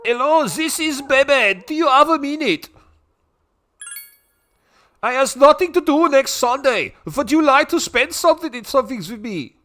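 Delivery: high, loud, hammed-up foreign accent; excitable caller with a thick mock-European accent; Bebe. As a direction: put on a high, slightly German-sounding French voice. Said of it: "he's meant to be a French character".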